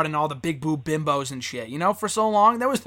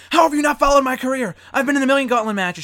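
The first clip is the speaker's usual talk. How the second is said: higher, louder mock outrage; hammed-up offended diva voice; mock indignant Maurice; 7 semitones higher, 5 dB louder than usual. Cohost mocks Maurice's huffy reply to Nia Jax, mock indignant and louder. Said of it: "And Maurice is like".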